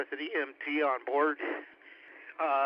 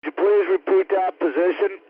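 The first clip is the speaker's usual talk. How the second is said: much louder, pushing the question hard over the radio; urgent shout; 2 semitones higher, 10 dB louder than usual. Real, not acted. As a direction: call out urgently so the rescuer hears. Urgent radio call during rescue.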